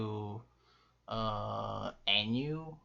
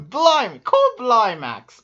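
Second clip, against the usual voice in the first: high, loud hammed-up British "cor blimey"; mock British exclamation; 10 semitones higher, 12 dB louder than usual. Host punning on a player's name, mock British outcry. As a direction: exclaim like a cartoon Brit, pitched way up. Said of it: "You're British?".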